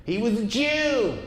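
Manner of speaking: high, shrill yelling from the crowd; heckling woman. Retold or imitated. Comic imitating a woman heckler at his show, high and yelled. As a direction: screech it out like a heckler in the back row. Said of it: "a woman yelled it out at my show".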